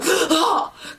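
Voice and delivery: sharp acted gasp; dramatic gasp. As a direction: gasp as if braced for a shock. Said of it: "really bracing for that whole".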